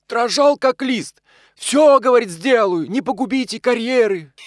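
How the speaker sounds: high, loud, crowing retelling; stretched, high-pitched mocking boast; gleeful mockery. A narrator's reading. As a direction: mimic the begging victim with tipsy glee. Drunk pal gleefully mimics frightened victim.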